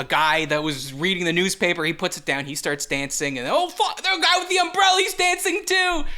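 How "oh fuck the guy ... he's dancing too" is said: high, loud mock astonishment; amazed excitement. Bystander watching a flash mob break out, hammed-up high excitement. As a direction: shout it like a stunned onlooker.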